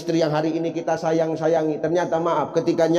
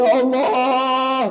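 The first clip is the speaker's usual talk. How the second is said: higher, slow, hammed-up wail; stretched, much higher fake sob cry; louder mock wail; 7 semitones higher, 3 dB louder, much slower than usual. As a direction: cry out as the greedy husband. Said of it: "kata bapak tuh".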